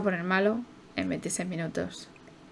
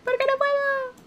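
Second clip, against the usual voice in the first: much higher, thin put-on whine; squeaky Sim voice. Voicing a Sim who can't do something, squeaky. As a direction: play the sulky little Sim.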